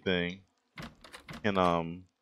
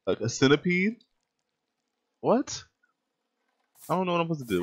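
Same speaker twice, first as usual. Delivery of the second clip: disbelief; 10 semitones higher than usual. Real, not acted. Disbelief at centipede enemy.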